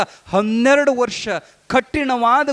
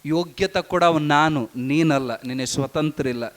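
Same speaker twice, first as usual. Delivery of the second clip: put-on low, firm voice of the old guru settling it; stern guru; 6 semitones lower than usual. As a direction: lower guru voice, closing the argument. Guru overrules the disciple.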